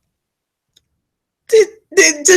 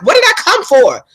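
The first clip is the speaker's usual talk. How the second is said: put-on high, louder puzzled old lady; mother voiced; 10 semitones higher, 4 dB louder than usual. The mother at the show, asking high and puzzled. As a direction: ask it like a confused mom, pitched up. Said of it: "she was like".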